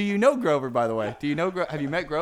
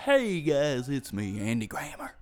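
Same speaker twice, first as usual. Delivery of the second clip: lower, drawn-out put-on voice; 3 semitones lower, slower than usual. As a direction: announce yourself in a low mock-celebrity voice. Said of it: "[name]'s here, everybody".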